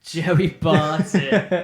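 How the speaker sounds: drawn-out disbelief. Real, not acted. Reacting to a friend picking Joey Barton, slow disbelief. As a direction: drag the name out in mock horror.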